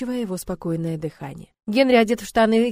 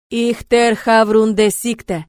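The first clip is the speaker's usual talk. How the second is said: loud, slow foreign pronouncement; commanding monster; 2 semitones higher, 9 dB louder, much slower than usual. A narrator's reading. Arch speaks to the captive in his language, loud and commanding. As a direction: boom it out slowly in the creature's tongue.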